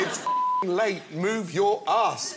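impatient chef order. Acted. Gordon hurrying the host off set, slow impatient order. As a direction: drag each word out to make him move.

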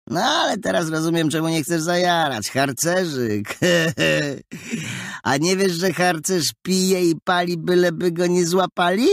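put-on high, jeering boy with snickers; mocking bully. A narrator's reading. Story bully mocks the scout.

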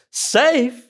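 high, loud, worked-up outburst; an excited beaver who can't believe the question; excited Mister Beaver. Acted. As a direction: burst out indignant and thrilled at the question. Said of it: "Mister Beaver gets really excited".

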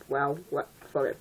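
plain reading voice

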